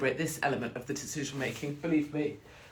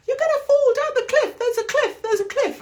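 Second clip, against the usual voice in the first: very high, loud alarmed shriek; mock panic scream; 9 dB louder than usual. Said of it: "my brain would start screaming".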